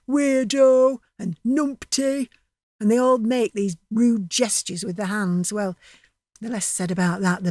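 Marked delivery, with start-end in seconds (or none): 0.1-2.3 mock shout. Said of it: "he shouts at Max".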